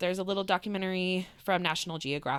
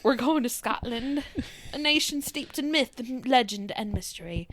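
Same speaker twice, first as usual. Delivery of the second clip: put-on terrible Scottish accent; 4 semitones higher than usual. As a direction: read it like a bad Scottish travel ad. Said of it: "not gonna do the accent ever again 'cause that was terrible".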